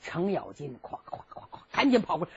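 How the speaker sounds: usual speaking voice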